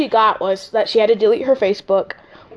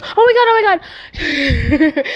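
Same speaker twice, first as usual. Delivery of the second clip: panicked; 6 semitones higher, slower than usual. Retold or imitated. Voicing sister panicking.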